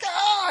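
loud, high mock "ah"; exasperated yelp, hammed up; disgusted cry. Owner seeing muddy indoor dog, disgusted cry.

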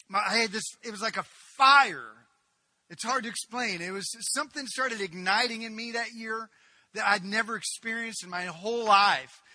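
loud, high, building testimony; fervent preaching. Acted. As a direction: let the fire build as you tell it.

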